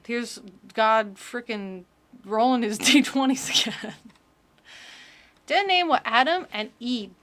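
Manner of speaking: ordinary talk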